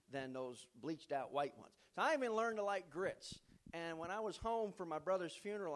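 ordinary talk

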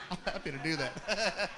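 laughing. Real, not acted. To the congregation, joking about the awkward call, laughing. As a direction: chuckle through the joke. Joke about calling the other candidate.

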